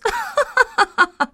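high-pitched peal of laughter; sprites' laughter. A narrator's reading. Sprites laugh at the quarrel over noses, laughing. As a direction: burst into a high, acted laugh. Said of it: "Smáli se skřítkové".

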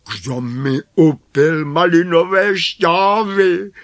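high, loud dwarf question; loud, high-pitched dwarf complaint; indignant seventh dwarf. A narrator's reading. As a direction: demand an answer in a small dwarf's voice. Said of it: "ptal se sedmý".

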